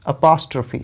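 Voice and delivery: अपॉस्ट्रॉफी with a short "uh" and stressed POS; correct pronunciation. Shown as a demonstration. Teacher models the word for students, correct अपॉस्ट्रॉफी stressed on POS. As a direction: stress the second syllable of अपॉस्ट्रॉफी, uh-POS-truh-fee. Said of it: "तो कैसे आप pronounce करेंगे".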